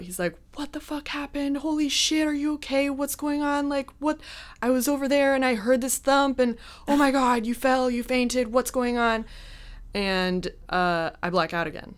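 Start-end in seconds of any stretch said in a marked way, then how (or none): 0.5-9.2 high-pitched, panicked stream of questions; imitating freaking out. Date kneeling over the fainted woman, freaking out. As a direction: play the alarmed date, pitched up throughout. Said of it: "he's freaking out".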